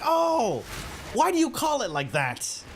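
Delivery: high mock mom voice. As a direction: toss it back like a puzzled mom. Mom's reply voiced.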